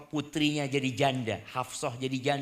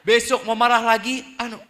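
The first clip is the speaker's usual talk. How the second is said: usual speaking voice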